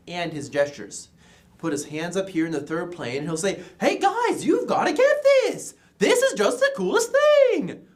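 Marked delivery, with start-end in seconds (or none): 3.8-7.9 very excited, high loud pitch to the group; Brother Shetler. Retold or imitated. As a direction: hype them up with huge, high-pitched energy. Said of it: "how he shows excitement and energy".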